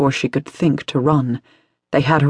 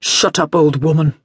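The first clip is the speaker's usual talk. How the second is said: curt, contemptuous snap; snapped. A narrator's reading. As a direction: cut the old woman off. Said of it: "the man snapped".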